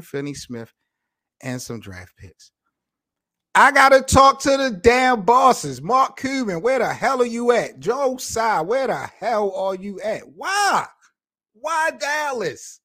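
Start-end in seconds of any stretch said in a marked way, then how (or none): 3.5-10.2 loud, high angry call-out; real furious outburst; furious rant. Real, not acted. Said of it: "I'm so mad right now". 10.3-12.8 high, slow pained "Why Dallas?"; high drawn-out anguished question. Real, not acted. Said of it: "I'm so mad right now".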